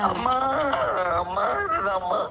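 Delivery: sobbing agreement; weeping as she is understood. Real, not acted. Caller sobbing as psychic speaks.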